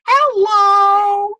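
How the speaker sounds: sudden very high, loud interruption; piercing mimicked truck holler; imitated truck yell. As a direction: blast it over the serious scene. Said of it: "And it was so loud".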